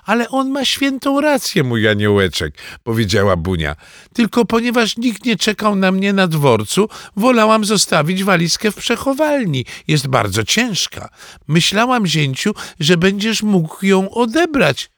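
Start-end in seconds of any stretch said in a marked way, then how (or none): 0.0-2.6 high, cooing granny voice; doting grandmother. Acted. Grandmother defends her darling grandson. 4.1-14.9 high-pitched, reproachful granny; grandmother's high grumble; complaining grandmother. Acted. Narrator voices reproachful grandmother.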